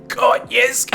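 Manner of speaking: hammed-up triumphant old man; mock cackling old bloke. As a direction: crow it out like a prankster. Quoting the laughing old bloke.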